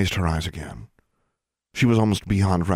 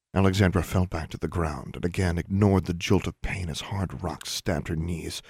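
ordinary delivery, nothing marked

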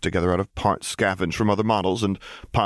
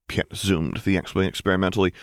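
ordinary delivery

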